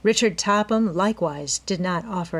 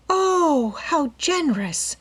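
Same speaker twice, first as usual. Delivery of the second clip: ordinary delivery, nothing marked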